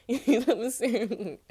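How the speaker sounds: high, loud laughing question. Real, not acted. Tarot reader laughing at her own joke to viewers.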